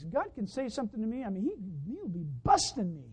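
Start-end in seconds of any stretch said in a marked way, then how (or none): none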